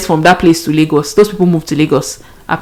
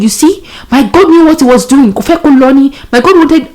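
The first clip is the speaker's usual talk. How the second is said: a hint of a knowing mother, higher; mother telling her daughter God knew best, higher; lightly voiced mom; 7 semitones higher than usual. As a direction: mom saying I told you so, pitched up. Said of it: "My mother said".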